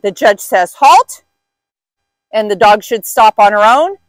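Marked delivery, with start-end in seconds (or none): 0.7-1.2 loud, high call of halt; called-out command. Retold or imitated. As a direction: call it across the ring. Judge calling out the halt.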